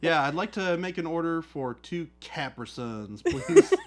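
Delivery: hammed-up accent, stretching "Capri Suns"; put-on silly accent. Customer ordering two boxes of drinks, hammed-up accent. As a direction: order in a comically exaggerated accent. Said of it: "I have my very normal accent, and then I go".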